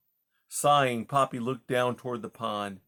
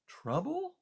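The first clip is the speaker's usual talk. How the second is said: high, softly voiced startled question; alarmed mouse; 6 dB quieter than usual. A narrator's reading. Mouse hears that Rye is in trouble, small alarmed cry. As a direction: echo it in small, high dismay. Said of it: "Poppy cried".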